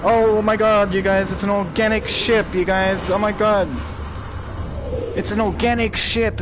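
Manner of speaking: high, loud excited gush; thrilled squeal at the ship. Real, not acted. Excited discovery in VR game.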